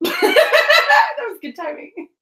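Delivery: high, slow laugh through the words; giggling at the timing. Real, not acted. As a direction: laugh it out, amused. Laughs at her own joke.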